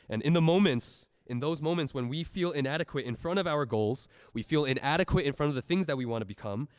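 impassioned sermon climax; preaching at full force. Acted. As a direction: drive the message home from the pulpit.

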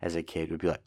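normal talking voice

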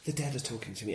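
low, quiet mock medium; hushed mimicry. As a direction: imitate a casual confiding claim, almost under the breath. Mimics self-styled mediums.

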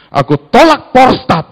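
mock commanding prayer; loud rebuke prayer. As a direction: bark the rebuke like at the altar. Retold mistaken prayer, loud rebuke.

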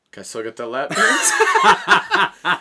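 loud, slow, laughing request; cheeky laughing plea; laughing cheeky ask. Retold or imitated. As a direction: push your luck while giggling. Cheeky request after being caught.

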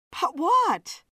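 high, loud but what; sharp question. Acted. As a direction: demand he finish. Joan pressing for the rest.

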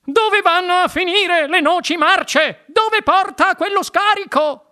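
slow, high-pitched squawk. A narrator's reading. Mrs Salt demands where the chute leads, squawking. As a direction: squawk the questions, stretched out and frantic. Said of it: "starnazzando e agitando le braccia".

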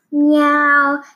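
high, loud taunting cat meow; mock meow. Big brother taunting little brother, loud mock meow. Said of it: "he thinks this is hilarious".

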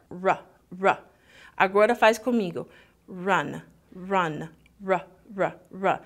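rolled-back English r with tongue movement; English r demo. Shown as a demonstration. Teacher leading students in practicing the English r. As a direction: show the English r in Run. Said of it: "se você faz o som de R".

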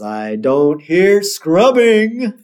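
put-on preacher calling out, stretched; Reverend Lovejoy. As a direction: draw out every word like a minister calling. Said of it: "And he just says".

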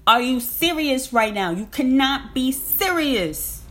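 hammed-up can't-believe-it outburst; incredulous. On finally picking up a book, a loud incredulous outcry. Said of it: "I was like".

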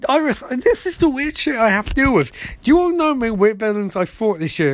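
high-pitched, worked-up protest; hammed-up venting, pitched well up; mock exasperated rant. Barry defends his secret life to Iris, mock exasperation.

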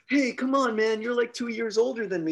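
put-on high, pleading kid's complaint; mock whining kid; whiny protest. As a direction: beg for fairness like a bratty kid. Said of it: "the guy's like".

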